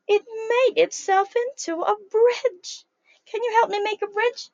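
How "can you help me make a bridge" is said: usual speaking voice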